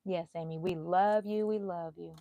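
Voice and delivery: ordinary talk, nothing marked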